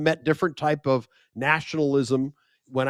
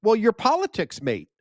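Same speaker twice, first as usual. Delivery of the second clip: Australian promoter; 7 semitones higher, 3 dB louder than usual. Retold or imitated.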